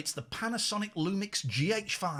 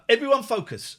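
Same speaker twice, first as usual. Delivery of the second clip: normal talking voice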